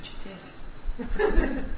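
slow whispered praise. Retold or imitated. Voice coach telling the preacher he finally hit it, whispered. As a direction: breathe it out softly. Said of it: "She said".

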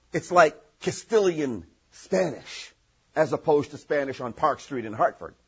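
normal talking voice

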